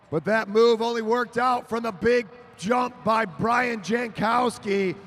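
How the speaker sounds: announcer hailing the winner; excited wrap-up. Real, not acted. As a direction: celebrate the winning move.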